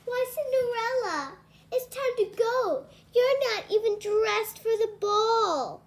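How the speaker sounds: high, sneering stepsister voice; high, taunting stepsister; mocking stepsister.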